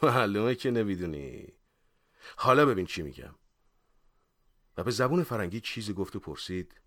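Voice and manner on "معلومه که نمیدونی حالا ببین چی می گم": mildly scornful, knowing tone; sneering. Acted. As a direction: talk down with a light sneer. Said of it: "باز پوزخند زد و گفت". (با پوزخند و تمسخر)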